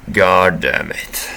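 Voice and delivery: slow, loud annoyed curse; gruff Vincent grumble. Acted. Vincent cursing as his ears show, slow. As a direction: drag it out grudgingly.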